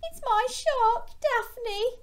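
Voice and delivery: very high, slow, tearful little fish; mock sobbing. Rita grieving for Daphne, high acted sobbing. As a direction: wail it in a high storybook voice. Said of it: "Rita sobbed".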